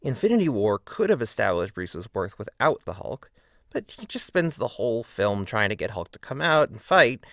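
ordinary delivery

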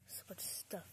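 ordinary talk

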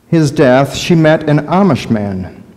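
usual speaking voice

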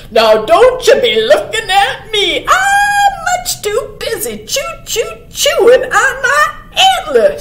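high, indignant cartoon voice; sputtering porcupine. A narrator's reading. Porcupine too busy chewing to go, high sputtering protest. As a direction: sputter and protest, stretching the chews. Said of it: "sputtered the porcupine".

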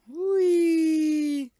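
high drawn-out whee of delight; high joyful whoop; 1 gleeful shout. Real, not acted. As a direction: let it fly up like a ride. Joyful shout while flying.

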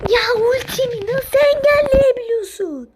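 loud disbelieving protest; outraged cry. Real, not acted. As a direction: wail at the blocked ultimate. Ultimate blocked once more.

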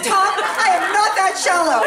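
hammed-up offended retort; mock indignant. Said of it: "I'm like".

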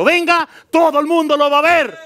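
high loud proclamation; preaching shout. Real, not acted. Preacher proclaiming that everyone will see Jesus, loud and high. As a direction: shout it to the whole church.

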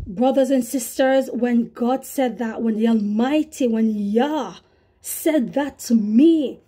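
normal talking voice

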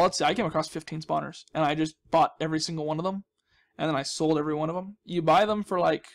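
usual speaking voice

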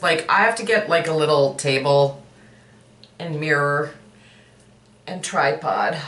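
speaking through fingers; fingers over mouth. Real, not acted. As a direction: hand partly covering mouth. Seen 0: Fingers cover mouth and nose at +0.3, +0.6, +2.2, +2.4.

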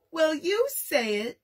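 high, slow, tearful protest; sobbing. Acted. Jack sobs back at Dad as he's sent away. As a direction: blubber it out like a hurt little boy. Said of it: "Jack sobbed".

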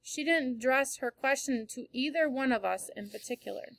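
ordinary delivery, nothing marked

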